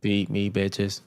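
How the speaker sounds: slow mechanical demand; robot voice, drawn out; toy robot voice. Pretend. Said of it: "I am a robot".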